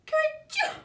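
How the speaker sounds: high, loud squeaky sneeze. A narrator's reading. Hidden rabbit giving himself away, squeaky sneeze. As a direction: burst out a tiny rabbit sneeze.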